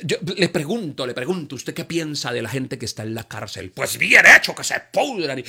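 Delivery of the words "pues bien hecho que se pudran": loud scornful outburst; scornful exclamation. Retold or imitated. Congregant glad at prisoners' fate, loud scornful outburst. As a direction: spit it out with contempt.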